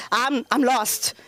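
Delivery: ordinary delivery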